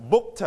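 "booked" as book-tuh; separated final t. Shown as a demonstration. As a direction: release the t of "booked" as its own sound. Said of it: "we pronounce".

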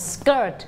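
SKIRT said clearly for choral repeat; teacher modelling the word "skirt"; model pronunciation. Shown as a demonstration. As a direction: lead a class in saying "skirt". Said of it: "พร้อมๆกันใหม่".

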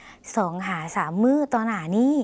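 slow, clear repeat of สองหาสามมื้อต่อหน้านี้; slow Lao demo. Shown as a demonstration. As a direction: repeat it slowly for learners. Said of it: "ลองออกเสียงภาษาลาว". (อ่านซ้ำช้าๆ ให้ผู้ชมพูดตาม)

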